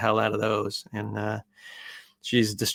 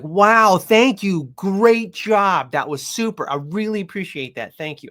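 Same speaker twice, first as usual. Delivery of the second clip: high, louder gushing praise; excited praise; 8 semitones higher, 5 dB louder than usual. Retold or imitated. Owner praising her puppy for letting go, high excited gush. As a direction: gush over her like she did something amazing. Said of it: "I'm like".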